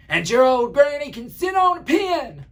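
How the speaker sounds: high, loud pig voice yelling, slowing at the end; a rude little porker shouting the wolf off. Acted. Third pig yells at the wolf through the door, high and loud. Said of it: "pig yelled".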